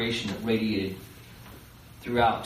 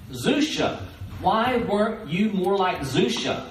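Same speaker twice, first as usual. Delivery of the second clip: God asking slowly; 8 semitones higher, 4 dB louder, slower than usual. Retold or imitated. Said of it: "if he asks me".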